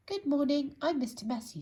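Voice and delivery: perky storybook character; cheery Mister Messy. Acted. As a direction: greet him brightly as Mister Messy. Voiced character greeting.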